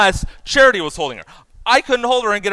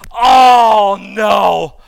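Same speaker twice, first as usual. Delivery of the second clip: loud, drawn-out mock dismay; hammed-up cry of dismay from on high; mock-helpless God; 4 semitones higher, 11 dB louder than usual. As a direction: wail a big helpless "oh no". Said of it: "God's up in heaven saying".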